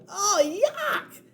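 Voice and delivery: high yuck of disgust; high disgusted outcry; disgusted cry. Acted. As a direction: recoil from the gross detail. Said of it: "cried Annie".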